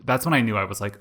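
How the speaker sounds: normal talking voice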